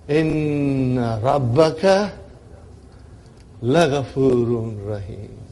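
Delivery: slow recitation, long held first syllable; drawn-out verse recitation; measured recitation. Shown as a demonstration. Recites the rebuilt verse to class.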